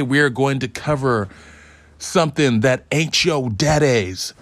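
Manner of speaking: usual speaking voice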